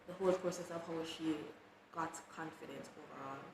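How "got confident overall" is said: ordinary delivery, nothing marked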